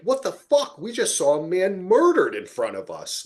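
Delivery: mock shock. Podcast host imagining the women reacting, mock shocked. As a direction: act out the horror they should have shown. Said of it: "or like".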